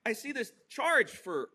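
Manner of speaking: ordinary delivery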